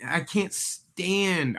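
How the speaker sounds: exasperated, with STAND drawn out; exasperated rant. Real, not acted. Co-host starting a mini rant about market comments, exasperated. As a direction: stretch STAND slowly in real exasperation. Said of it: "I'll go off on a mini rant".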